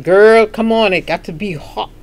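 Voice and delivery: a light Dominican accent on a retold flirty line; hint of a Dominican accent quoting Mister DeLuca. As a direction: playfully imitate his Dominican English, the opening loud. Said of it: "that accent all day".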